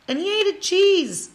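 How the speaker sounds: slow high amazed retelling; incredulous. Retold or imitated. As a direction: stretch it out, still can't believe it.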